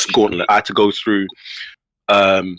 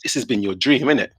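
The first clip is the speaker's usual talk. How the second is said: put-on hushed English accent, near whisper; whispered aside in a crisp English accent; perfect English accent; 5 dB quieter than usual. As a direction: imitate him in a soft, sinister English murmur. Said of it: "hit the perfect English accent".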